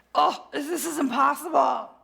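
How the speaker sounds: a half-laughing, whiny cry of despair; whiny sock puppet self. Acted. Speaker's puppet self complains to God, whiny and laughing. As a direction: wail it like an overwhelmed little puppet. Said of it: "That's me, sock puppet".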